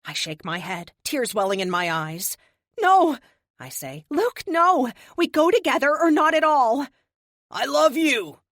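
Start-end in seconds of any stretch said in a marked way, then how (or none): 2.8-3.2 high, much louder cry; tearful shout of refusal; anguished shout. A narrator's reading. Luke plans to sacrifice himself. 4.1-6.9 high, loud tearful pleading; desperate shouting. A narrator's reading. Begging Luke not to sacrifice himself. 7.5-8.4 loud and higher, shouted farewell; shouting his farewell over the noise; yell over noise. A narrator's reading. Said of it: "he yells over the noise".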